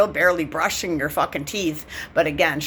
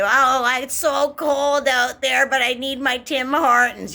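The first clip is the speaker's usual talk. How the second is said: mock whine; 5 semitones higher than usual. Mocking coffee addict.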